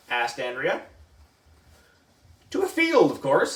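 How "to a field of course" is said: high, obvious answer; teacher stating the obvious; matter-of-fact teacher. Acted. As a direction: say it like it couldn't be more obvious. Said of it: "said Mister Docker".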